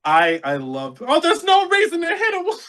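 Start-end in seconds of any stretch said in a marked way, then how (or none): 1.1-2.6 very high, hammed-up mock voice; mock-indignant falsetto protest; comedy-bit impression. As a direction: squeak it out like a comic caricature. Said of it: "said it just like him".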